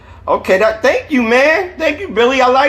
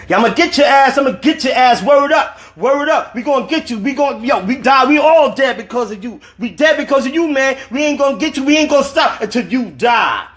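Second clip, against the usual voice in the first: taunting dream voice; 10 semitones higher than usual. A narrator's reading. Dream voice taunting sleeping Dwayne, high and jeering. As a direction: voice the dream tormentor jeering at him. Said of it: "continued to taunt".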